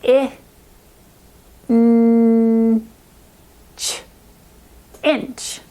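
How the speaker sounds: "I", "n", "ch" tapped separately, then "Inch" blended; sounding out inch. Shown as a demonstration.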